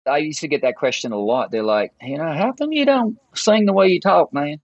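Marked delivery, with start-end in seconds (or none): 2.0-4.6 higher, put-on puzzled voice; lightly mocking higher-pitched query; mimicked questioner. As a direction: imitate someone asking a curious question. Mimics people asking about his accent.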